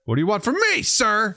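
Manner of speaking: hammed-up exasperation; mock defiant retort. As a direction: tell off the imagined truck guy. Imagined defiant reply to locals.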